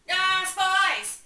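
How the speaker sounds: very loud, high battle cry; mock alarmed shout. Hamlet discovering someone hidden, shouting in mock alarm. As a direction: yell it out, hammed-up. Hamlet cries out, then stabs.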